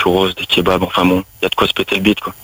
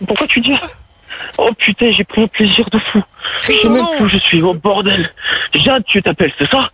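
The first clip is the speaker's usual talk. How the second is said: mock dazed afterglow; 11 semitones higher than usual. Improvised sex scene, after climax.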